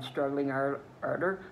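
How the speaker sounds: plain reading voice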